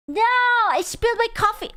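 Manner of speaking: high, quick wail; dismayed. Real, not acted. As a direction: cry out over a small disaster in a rushed high voice. Just spilled coffee.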